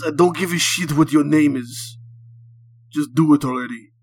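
put-on loud, impatient Russian-accented outburst; aggravated Russian. A narrator's reading. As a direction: cut in angrily with a thick Russian accent. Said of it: "your obvious aggravation towards the current situation".

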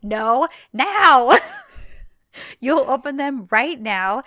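high, loud mock order; angry demand. Retelling Eda ordering Serkan to free her, angry and loud.

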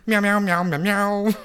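high, loud playful meows; cat meowing. Acted. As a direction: meow like a cat on a mission. Cat agent suiting up.

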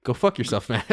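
a hint of a replayed recording; replayed tape voice. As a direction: deliver it like a tape played back. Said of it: "Johnny, roll back the tape".

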